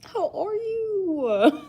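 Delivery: high, slow cutesy imitation; imitating her cute voice. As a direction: squeak it out sweetly like her. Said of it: "she was like".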